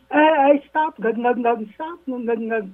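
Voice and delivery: high frantic yells of stop; yelling stop. Retold or imitated. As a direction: shout at a runaway donkey. Tên cướp hét con lừa ngừng. (tên cướp hét cao giọng bảo con lừa ngừng)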